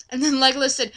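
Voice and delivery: ordinary talk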